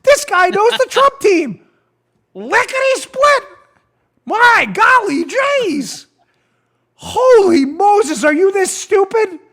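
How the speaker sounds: a giddy Batman-sidekick fool, amazed; mock dumb FBI agent. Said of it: "And they're like".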